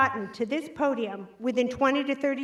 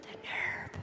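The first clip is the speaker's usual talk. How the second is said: whispered mock indignation; hushed aside; 16 dB quieter than usual. Comic after the Starbucks coffee joke, mock-offended whisper. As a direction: drop to a very soft, whispered aside.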